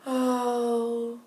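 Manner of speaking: a soft, sad little oh; sad sigh. Acted. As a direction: sigh it out, downcast. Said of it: "she sighed sadly".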